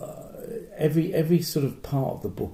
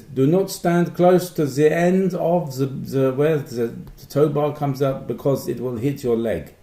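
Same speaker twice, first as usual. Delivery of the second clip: put-on slow, higher German accent reading a warning; mock German accent; 4 semitones higher, 3 dB louder, slower than usual. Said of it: "is that more German?".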